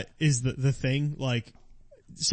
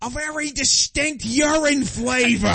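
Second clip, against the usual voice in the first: higher, louder, slow Gilbert Gottfried-style whine; a light comic Gilbert Gottfried impression; 7 semitones higher, 5 dB louder, slower than usual. Detective asking about the Coke in a Gilbert Gottfried voice. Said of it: "sounded like Buddy Hackett".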